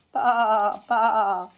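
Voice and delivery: loud playful bleating baa; lamb bleat. A narrator's reading. Lambs bleating.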